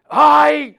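sharp, high, loud "Ay!" of dismay; startled cry. Retold or imitated. A man finds his helper speaks no Spanish, loud startled cry. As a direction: blurt it out like a man caught off guard. Mexican man reacts, walks off.

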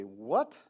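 "What?" swooping sharply up at the end; incredulous "What?", pitch climbing steeply; big question mark. Acted. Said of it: "with a big question mark".

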